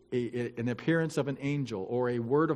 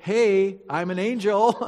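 exasperated angel; 6 semitones higher, 7 dB louder than usual. Pretend. Angel exasperated with doubting Zechariah.

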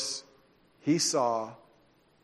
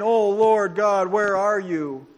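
crying out to God; 4 semitones higher, 5 dB louder than usual. Retold or imitated. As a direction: cry out to God in despair. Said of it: "and he's crying".

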